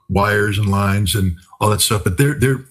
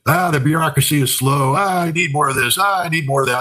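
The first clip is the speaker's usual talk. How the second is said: put-on old people complaining; 7 semitones higher than usual. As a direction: imitate old folks grumbling in a higher pitch. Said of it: "It's normal old people complaints".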